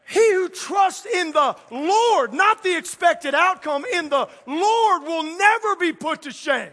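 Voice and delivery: loud, slow, high-pitched preaching; preaching at full force. Acted. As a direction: proclaim it to the whole church. Preaching climax, shouted scripture.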